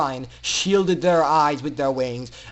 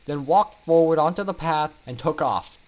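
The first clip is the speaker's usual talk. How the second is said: usual speaking voice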